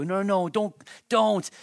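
hammed-up no no don't; mock protest. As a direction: push the gift away, embarrassed. Acting out refusing an undeserved gift.

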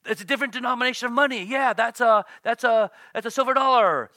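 excited student, mock shout; eager guessing. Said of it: "now they realize it's".

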